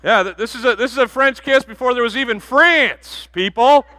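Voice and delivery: high, loud and laughing through the punchline; delighted preacher cracking the room up; excited joking. Real, not acted. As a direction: build to a laughing peak. Pastor jokes, voice rising.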